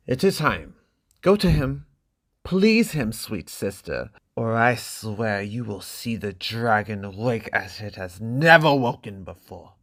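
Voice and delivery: a cruel brother pressing a warning; threatening brother. Acted. Brother threatening his sister before the wedding night, menacing.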